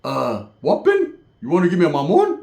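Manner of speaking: a hammed-up confused voice; imitating a dazed cousin. Cousin answering a cop's question, dazed mock voice. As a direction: play it dopey and baffled. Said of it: "He's gonna be like".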